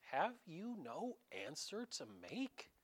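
a touch of a puzzled governor; Pilate asking. Retold or imitated. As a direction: prod him, baffled. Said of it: "Pilate turns to him ... and says".

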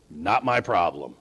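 low, toneless brush-off; dismissive indifference. Acted. Preacher voicing governors who ignore local custom, dismissive indifference. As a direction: drop it like it's none of your business.

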